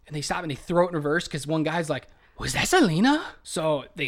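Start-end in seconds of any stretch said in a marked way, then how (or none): 2.4-3.3 higher, louder astonished question; excited disbelief. Retold or imitated. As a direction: mimic a starstruck double take. Retelling a fan spotting [name].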